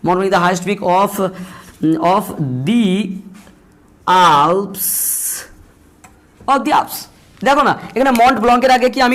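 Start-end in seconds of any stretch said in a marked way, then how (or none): 0.1-7.0 words drawn out slowly while writing them on the board; spaced-out classroom dictation. Shown as a demonstration. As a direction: dictate each word slowly, pausing between them.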